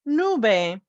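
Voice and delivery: ordinary talk, nothing marked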